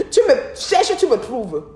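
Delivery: high, loud teasing challenge; defiant taunt. Real, not acted. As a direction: throw it out like a cheeky dare. Defiant retort after laughing.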